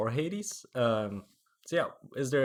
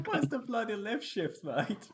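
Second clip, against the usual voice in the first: put-on higher, laughing British accent repeat; 10 semitones higher, 3 dB quieter, slower than usual. Repeating a friend's joke in a mock British accent. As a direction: echo the cockney British line, amused. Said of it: "exaggerated British accent".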